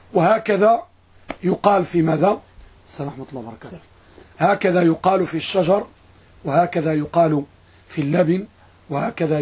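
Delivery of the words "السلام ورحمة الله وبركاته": much softer, low murmured greeting; quiet aside. Real, not acted. Teacher greeting someone mid-lesson, in a low, quiet aside. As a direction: drop the voice right down for a passing greeting.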